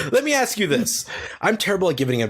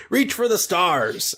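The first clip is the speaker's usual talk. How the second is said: mock inspirational slogan; 4 semitones higher, slower than usual. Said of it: "a slogan like".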